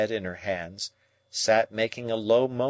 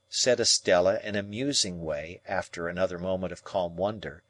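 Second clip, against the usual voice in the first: ordinary delivery, nothing marked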